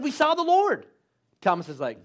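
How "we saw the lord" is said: high, loud acted exclamation; excited announcement. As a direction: burst out with thrilling news, pitched high. Disciples excitedly announce news to Thomas.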